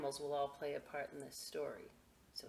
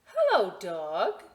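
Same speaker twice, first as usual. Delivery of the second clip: cheerful call; 3 semitones higher, 11 dB louder, slower than usual. Acted. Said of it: "said Duck".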